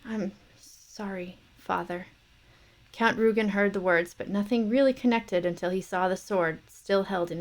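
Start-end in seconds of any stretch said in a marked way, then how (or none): 0.0-2.1 faint, slow, broken whisper; dying son whispering sorry to his father. Acted. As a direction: barely breathe the apology. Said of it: "when he whispered".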